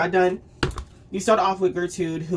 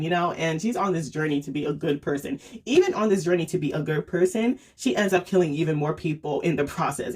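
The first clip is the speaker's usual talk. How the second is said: ordinary talk, nothing marked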